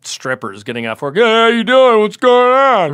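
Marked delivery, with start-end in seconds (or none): 1.2-2.9 higher and louder, a breezy charming hello; bright put-on friendly voice calling out; overly friendly greeting. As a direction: chat up the women casually, pitched up. Greeting strippers in the street.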